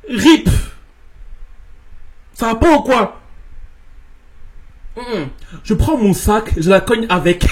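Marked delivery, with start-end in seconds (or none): none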